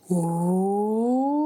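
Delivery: sliding ooh up and down through the range, relaxed throat; siren ooh. Shown as a demonstration. Teacher showing kids the harp's song, sliding siren ooh. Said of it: "a siren".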